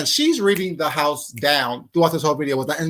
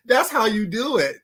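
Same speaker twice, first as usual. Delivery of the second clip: ordinary delivery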